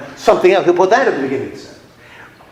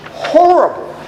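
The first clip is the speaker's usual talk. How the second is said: "Horrible" on its own, high and punched; emphatic horrible; 11 semitones higher than usual. Real, not acted. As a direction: repeat it with disgust. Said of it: "his style is horrible".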